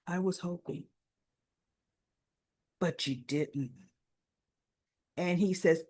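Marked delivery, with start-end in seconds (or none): 0.1-3.7 much softer and lower; subdued disappointment. Retold or imitated. Woman retelling a character confronting a man, quietly let down. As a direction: let it drop to a low, quiet regret.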